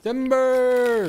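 long, high shout; calling timber. Pretend. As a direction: yell it like a lumberjack. Tree falling, calling out.

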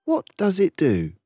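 put-on high, eager child's questions; child pestering mum with questions; curious child. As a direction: ask like a wide-eyed little boy. Boy questions his mother.

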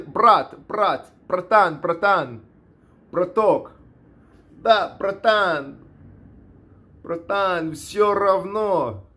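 Russian slang in a Caucasus fighter's manner; imitated Russian talk. Showing how Caucasus guys talk, imitated Russian accent. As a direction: imitate Russian-speaking fighters greeting each other. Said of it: "When you speak Russian, that's how they".

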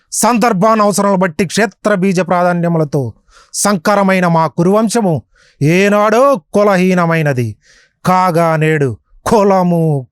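put-on high, loud, ringing stage declamation of an old mythological film; loud, high theatrical recitation in tribute to a film hero; NTR-style Karna dialogue. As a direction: thunder it out like a proud warrior defying his teacher. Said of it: "మీ నోట ఒకసారి పలికించండి ఆ డైలాగ్ని".

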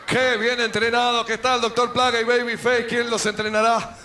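usual speaking voice

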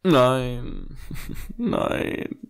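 usual speaking voice

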